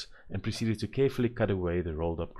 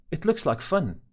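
eager; 9 semitones higher, 4 dB louder, slower than usual. A narrator's reading. Eager girl.

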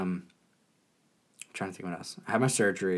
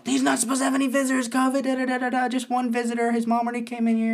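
much higher and fast, hammed-up fussy nurse; mocking nurse; 13 semitones higher than usual. Mocking the nurse kicking the pastor out, high and fast. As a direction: rattle it off like a frantic nurse. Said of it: "the nurse comes in crazy".

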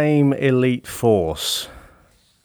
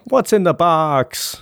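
slow, higher-pitched imitation of a movie line; mock film quote; 6 semitones higher, slower than usual. Podcaster quoting the thriller Seven, slow mock-dramatic delivery. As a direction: draw out the famous line, pitched up.

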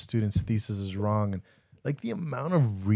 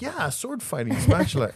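put-on much higher, excited little boy; eager kid; 11 semitones higher, 3 dB louder than usual. Son explains spatula sword fights to teacher, in a light mock kid voice. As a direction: chirp it out like a proud first grader. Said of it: "He goes".

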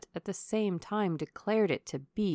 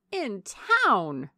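astonished cry; 2 semitones higher, 8 dB louder than usual. A narrator's reading. Lady astonished the colonel must go, crying out. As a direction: cry it out in disbelief. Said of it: "cried Missus Jennings".

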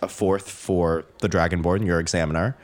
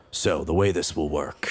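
low dragonborn voice; 5 semitones lower than usual. Acted. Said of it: "so the Dragonborn says".

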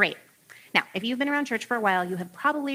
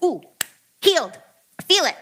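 mock excited; 5 semitones higher, 5 dB louder than usual. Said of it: "Jesus is like".

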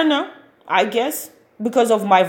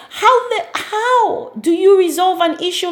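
incredulous laugh; 8 semitones higher than usual. Real, not acted. Exasperated laughing disbelief.

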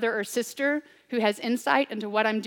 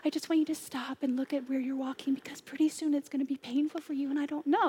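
soft tender whisper, higher; lovey-dovey whisper; 3 semitones higher, 6 dB quieter, faster than usual. Retold or imitated. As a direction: whisper it sweetly like a gentle friend. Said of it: "lovey-dovey".

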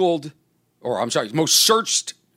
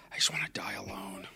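hushed, near-crying whisper; 5 semitones lower, 13 dB quieter than usual. Retold or imitated. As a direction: breathe it out like a despairing aside. Retold grim thought at bars.

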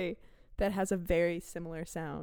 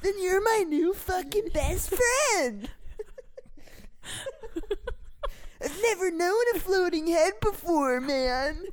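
a hyped-up bro character, pitched up; excited dude voice; 8 semitones higher, slower than usual. Acted. Improvised character, floating head.

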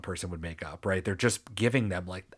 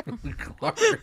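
Glark squealed much higher through a laugh; squeaky mock yell. Host mocking her own outburst, high squeal. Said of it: "You just yelled".

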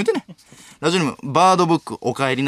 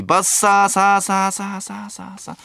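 higher drawn-out whoosh, fading away; fading sound effect; 5 semitones higher, slower than usual. Acted. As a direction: repeat it like an echo receding into the distance. Imitates a sound rushing past.

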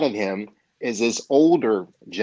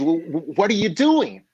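stammered, higher-pitched bewildered question; mock breathless protest; 6 semitones higher than usual. Half-drowned young man to his would-be teacher, mock breathless protest. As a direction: voice jumps up, lightly acting out alarm. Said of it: "he's gasping for breath".

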